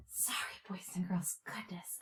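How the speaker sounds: whispered aside. Real, not acted. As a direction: whisper it to the class. Aside after puppet prank.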